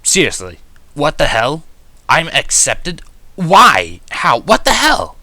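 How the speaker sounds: escalating incredulous yell; shocked outburst. A narrator's reading. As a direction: disbelief spilling over into shouting. Stunned outburst at being accepted.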